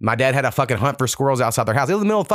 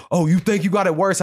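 higher-pitched indignant comeback; defensive; 7 semitones higher than usual. Acted. Poor whites pushing back, defensive. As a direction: mimic someone getting defensive. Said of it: "get very defensive".

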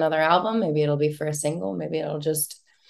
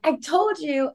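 whiny I-told-you; 11 semitones higher than usual. Retold or imitated. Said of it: "I was like".

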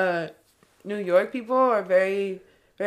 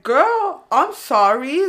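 high, loud sarcastic sorry; sassy mock apology; 5 semitones higher, 7 dB louder, much slower than usual. Pushed by a rude lady, she answers with a high sassy sorry. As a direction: hit girl way up, dripping sarcasm.

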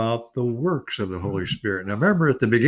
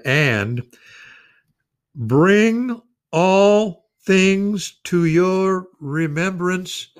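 "bring all things" drawn out very slow and higher, each word weighed; emphasized slowly; 7 semitones higher, 4 dB louder, much slower than usual. Shown as a demonstration. Elderly preacher reading the promise to his congregation, slow and emphatic. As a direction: stretch "remembrance" out, slow and lifted, leaning on every word. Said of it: "just emphasize that word".

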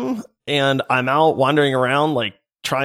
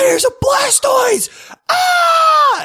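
excited shout; 5 dB louder, much slower than usual. Retold or imitated. Kids yelling about Pokemon.

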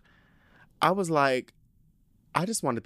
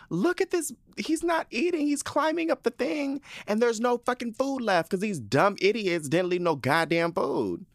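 much higher, mock outraged scream; high-pitched retold yell at the screen; 13 semitones higher than usual. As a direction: re-enact yelling at the TV, pitch way up. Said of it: "I was screaming in my house".

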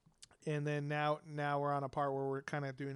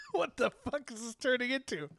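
incredulous; 8 semitones higher than usual. Real, not acted. Reacts to the NPC interaction getting flirty.

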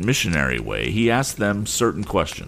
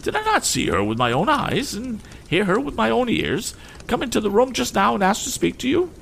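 much higher, outraged lady; indignant lady. Acted. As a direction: accuse him in a high huff. Mrs Pocket accuses.

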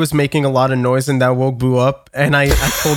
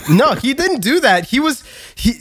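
ordinary talk

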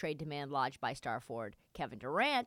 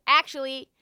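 imitating a loud correction; 7 semitones higher, 9 dB louder than usual. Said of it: "he says".

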